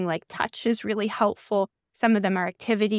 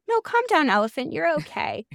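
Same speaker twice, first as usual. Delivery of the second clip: high, gentle hushing as if to a big animal; mock soothing an elephant; 6 semitones higher than usual. Host pretending to calm her inner elephant, soothing and high. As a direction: coax a panicked beast to settle.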